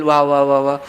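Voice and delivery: annoyed wife mockingly greets husband home at midnight; sarcastic welcome. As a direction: lightly imitate a wife's sarcastic welcome. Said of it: "कोणती बायको प्रेमानं दार उघडेल?".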